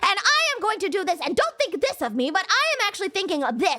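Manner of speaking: mock a long-winded author who never stops; Dostoevsky rambling. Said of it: "that's how he talks".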